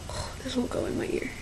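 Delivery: muttered whisper to herself; whispered aside. Real, not acted. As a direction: whisper it while fiddling with the earring. Struggling with earring, whispering.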